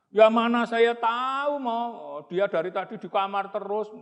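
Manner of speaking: whiny answer. Acted. Brother answering Romo.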